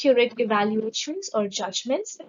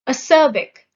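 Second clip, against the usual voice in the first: "Acerbic" with its middle syllable stressed, louder; stress on second syllable; 6 dB louder than usual. Shown as a demonstration. Teacher introducing the next vocabulary word, second syllable of "Acerbic" stressed. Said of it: "The stress is on the second syllable".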